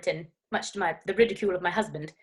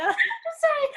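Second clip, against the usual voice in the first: laughing apology. Real, not acted. Exaggerated apology after laughing.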